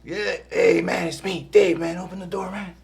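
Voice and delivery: high, spaced-out stoner at the door. Retold or imitated. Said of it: "the different skits".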